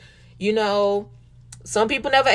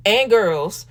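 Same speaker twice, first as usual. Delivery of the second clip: ordinary talk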